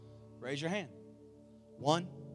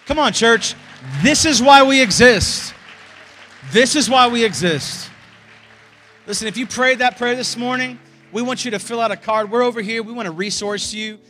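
loud, high rallying call; rousing shout; 7 semitones higher, 18 dB louder than usual. Real, not acted. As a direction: fire up the church. Pastor rallies congregation.

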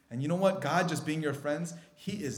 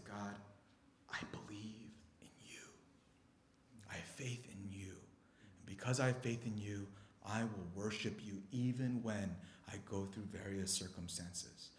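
very soft, low declaration of faith; hushed prayer; 4 semitones lower, 12 dB quieter than usual. Shown as a demonstration. Preacher shows how to speak to God, in hushed low worship. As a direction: pray almost under the breath, reverently.

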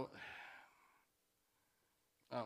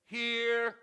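called out louder like a pupil at homeroom; student at roll call; 5 semitones higher, 10 dB louder, much slower than usual. Retold or imitated. Schoolkid answering the teacher's roll call, called out. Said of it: "you'd have to go".

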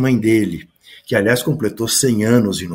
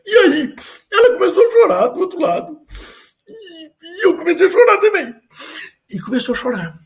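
crying Pelé. Retold or imitated. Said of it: "já chorando".

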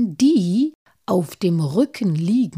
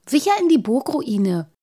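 higher, louder happy exclamation; calls it out, delighted; joyful call; 6 semitones higher, 5 dB louder than usual. Acted. Halbschuh solves the riddle for his friends, joyfully. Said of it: "rief Halbschuh freudig aus".

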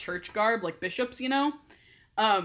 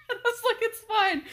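laughing. Real, not acted. Laughing reply.

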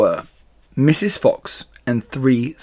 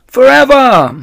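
high, loud shout of joy; the animals cheering Mister Fox's invitation, high and loud; joyful cry; 12 semitones higher, 10 dB louder than usual. Acted. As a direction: cheer the word out together. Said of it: "they cried".

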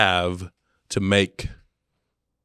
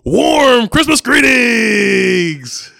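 very slow, high, loud shout; dad's last try on camera; drawn-out shouted greeting; 11 dB louder, much slower than usual. Retold or imitated. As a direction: stretch out every word at full volume. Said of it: "he jumps back in there".

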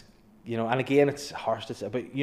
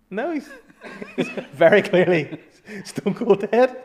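laughing; 9 semitones higher, 6 dB louder than usual. Retold or imitated. Quoting boss to ambulance.

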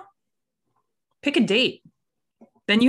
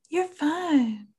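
a higher, airy mock zen reassurance; mock zen calm; 8 semitones higher than usual. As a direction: play the serene coach, lightly. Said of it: "I'll be all zen and be like".

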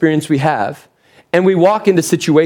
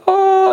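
high, held heavenly "ahh"; hallelujah-moment "oh"; 13 semitones higher than usual. Retold or imitated. Preacher acting out finding the maze's end, high hallelujah note. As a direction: sustain a high note like a choir of revelation. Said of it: "it's kinda like the hallelujah moment".

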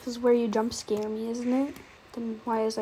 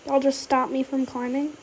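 ordinary talk, nothing marked

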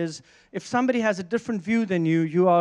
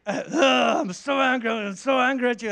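mock growl from an angry driver; growling angry noises; 2 semitones higher than usual. Angry driver yelling at the preacher, hammed-up growl. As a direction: snarl it out, hammed-up rage. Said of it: "he makes these noises".

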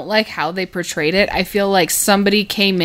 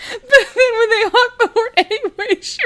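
laughing; 13 semitones higher than usual. Real, not acted. Laughing while retelling favorite Vine.

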